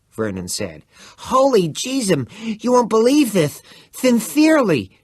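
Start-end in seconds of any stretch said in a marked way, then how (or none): 1.3-5.0 very high, loud breathless lisp; bursting with news, lisping "Sincerely"; excited lisping Vern. A narrator's reading.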